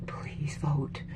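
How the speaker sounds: hushed, breath-only begging; whispered plea. Real, not acted. Pleading with viewers to vote.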